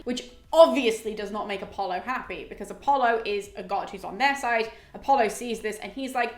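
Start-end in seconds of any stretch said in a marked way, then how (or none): none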